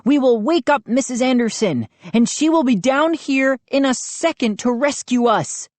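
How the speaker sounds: high-pitched threat; demanding. A narrator's reading. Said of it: "I demanded".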